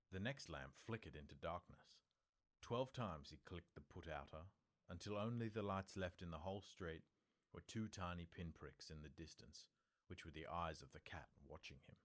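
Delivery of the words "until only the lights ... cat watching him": ordinary delivery, nothing marked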